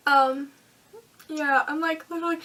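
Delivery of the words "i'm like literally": usual speaking voice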